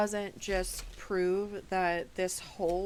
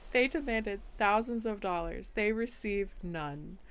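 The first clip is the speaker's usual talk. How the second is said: normal talking voice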